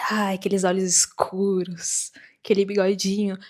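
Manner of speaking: dreamy, half-whispered gush; mock swooning. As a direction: swoon over him, drawing it out. Swooning over the man's eyes.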